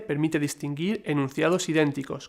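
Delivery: ordinary talk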